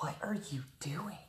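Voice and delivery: put-on a whispered sleepy kid question; imitating the kids. Mom imitating her kids waking, whispered. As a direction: mimic the kids just woken up, whispering.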